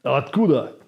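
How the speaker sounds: blunt clipped question; gruff local. Shown as a demonstration. Repeating the guy's Откуда as a demo. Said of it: "Like that".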